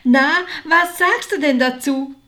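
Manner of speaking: high, loud, delighted challenge; triumphant excitement. A narrator's reading. Martha asks her sister after the big news, triumphant excitement. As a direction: toss the question out loudly, thrilled.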